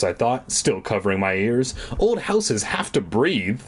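ordinary delivery, nothing marked

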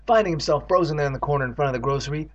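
ordinary delivery, nothing marked

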